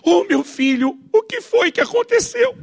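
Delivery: high-pitched, drawn-out motherly wail; fussing mother. Acted. Mother rushing to her fallen son, overdone fussing. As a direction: coo in exaggerated high maternal alarm. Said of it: "A mãe corre pro menino que acabou de cair".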